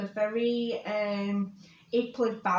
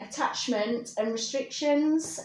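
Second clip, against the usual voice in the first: ordinary delivery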